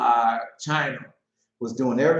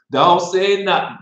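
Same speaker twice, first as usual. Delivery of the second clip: loud firm warning, stressing don't; 3 semitones higher, 9 dB louder than usual. Real, not acted. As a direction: hush him up firmly. Warning the publisher.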